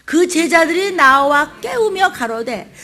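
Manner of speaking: congregation reading aloud. Shown as a demonstration. Preacher leading the church to read the verse aloud. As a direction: read it out together. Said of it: "시작".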